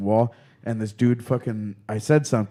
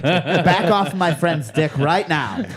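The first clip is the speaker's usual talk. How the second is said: loud, high, sassy protective voice; sassy mother hen; 6 semitones higher, 4 dB louder than usual. Acted. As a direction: defend your friend like a sassy mother hen. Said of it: "Whoa, he does do voices".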